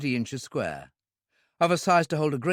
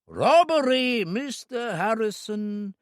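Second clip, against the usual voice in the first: higher, slow voice with relief leaking through; relieved villain; 9 semitones higher, slower than usual. A narrator's reading. Owner names the robbery to the gunman, relieved slow voice. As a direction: let the relief slip out slowly. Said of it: "tiny trace of relief out of his voice".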